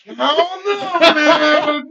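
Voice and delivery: laughing loudly, high, drawn-out words. Real, not acted. Guest joking about passing as American, laughing. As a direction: stretch it out high and loud through laughter.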